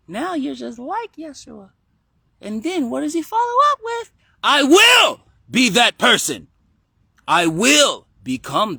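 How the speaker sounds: put-on high, loud child proclaiming; kid voice. Kid quoting Yeshua's promise, loud high child voice. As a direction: belt it out slowly like an emphatic kid. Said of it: "you just did it in the kid voice".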